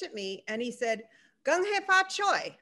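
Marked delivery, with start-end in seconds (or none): 1.5-2.5 bright, higher, eager New Year's greeting; light up and greet her happily. Retold or imitated. A stranger happily wishes her Happy New Year, eager and higher.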